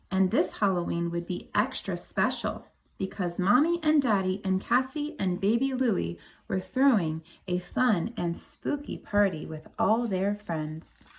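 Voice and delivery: ordinary delivery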